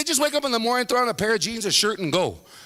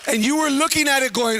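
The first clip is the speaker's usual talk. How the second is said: ordinary talk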